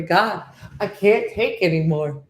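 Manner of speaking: slow, hammed-up cry to the heavens; mock anguished plea. Pretending to collapse at Chipotle, hammed-up plea to God. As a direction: beg the sky in fake despair.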